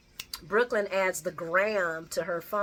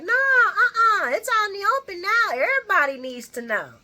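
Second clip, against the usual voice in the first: mock outburst; 12 semitones higher, 9 dB louder than usual. Sonny's outburst quoted.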